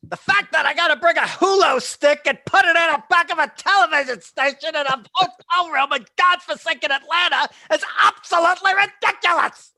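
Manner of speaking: screeching, very high and loud rant; Mad Dog impression. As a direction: rant like a frantic sports-radio host. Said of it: "doing his Mad Dog impression".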